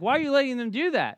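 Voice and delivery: mock-indignant questioning; imitated objection. Wife objecting, acted in story.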